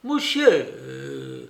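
drawn-out hail to the croupier; understated old lady's cry; calling out. A narrator's reading. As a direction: call for attention, stretching the word. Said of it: "cria-t-elle".

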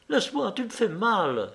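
high, pained whine; moaning. A narrator's reading. Woman whose hand is crushed, moaning. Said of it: "gémit Liuba".

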